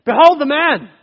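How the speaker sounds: presenting the prisoner. Retold or imitated. Pilate presenting the beaten man to the crowd, calling out. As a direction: call it out over the mob. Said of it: "And said".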